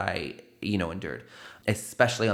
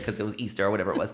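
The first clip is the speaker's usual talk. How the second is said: ordinary delivery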